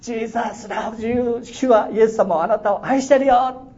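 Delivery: high, slow, loud proclamation; shouting out. Retold or imitated. The man obeying and shouting at the house, loud and drawn out. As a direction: belt it at the mailbox. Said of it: "大きな声で、".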